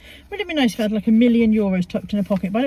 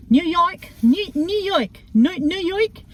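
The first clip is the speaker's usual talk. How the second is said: high-pitched put-on New York accent; hammed-up New York accent; 7 semitones higher, slower than usual. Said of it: "Why am I doing the accents?".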